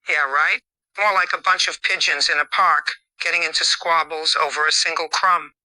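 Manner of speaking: usual speaking voice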